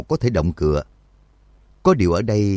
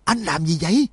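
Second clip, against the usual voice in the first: high, strained yell at no great volume; hoarse yell; 9 semitones higher than usual. A narrator's reading. Shin pins his companion down and yells hoarsely at him. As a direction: cry out hoarsely, pitched high. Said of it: "khàng giọng hét lên".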